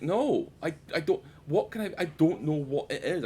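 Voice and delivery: high-pitched mock exasperation at being asked; exasperated protest. Retells exasperated protest to wife.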